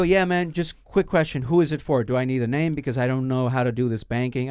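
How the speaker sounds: usual speaking voice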